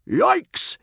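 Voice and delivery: high, loud startled yelp; yell. A narrator's reading. Gobber urging his mount Goliath to get going, yelling. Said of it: "yelled Gobber".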